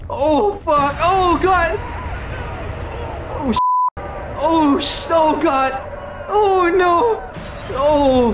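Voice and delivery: real anguished shouting at a horror; horrified cries. Real, not acted. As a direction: keep crying out in shock. Horrified by what happens onscreen.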